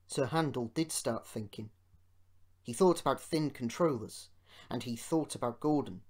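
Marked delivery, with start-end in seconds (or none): none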